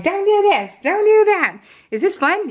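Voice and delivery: high, loud, bossy scolding of herself; mock bossy mom. Control-freak mom teasing her own bossiness, high and loud. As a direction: boss everyone around in a high, loud, teasing voice.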